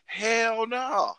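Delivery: high, drawn-out comic refusal; emphatic refusal. Acted. Man refuses Australia over toilet snakes, emphatic refusal. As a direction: wail it in mock horror.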